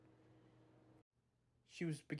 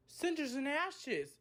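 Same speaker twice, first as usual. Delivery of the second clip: exclaiming; 10 semitones higher than usual. Acted. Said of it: "exclaimed Thomas".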